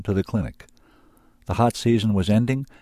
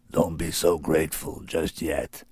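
low, slow, menacing creature voice; low slow beast warning; threatening beast; 3 semitones lower than usual. A narrator's reading. Sorin's implicit threat.

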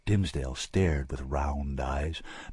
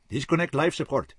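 a curt, raised command from the lead surgeon; snapped order; 9 semitones higher, 3 dB louder than usual. A narrator's reading. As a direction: snap the order at the team. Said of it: "he snapped".

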